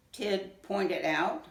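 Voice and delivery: ordinary delivery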